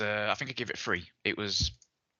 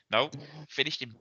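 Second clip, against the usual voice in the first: ordinary delivery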